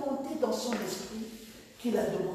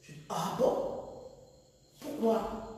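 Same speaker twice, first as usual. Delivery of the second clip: ordinary talk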